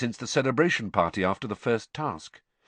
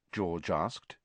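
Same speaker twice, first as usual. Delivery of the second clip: usual speaking voice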